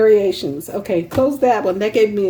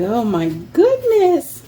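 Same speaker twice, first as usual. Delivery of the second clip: mock dismay; 4 semitones higher, slower than usual. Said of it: "And I'm just like".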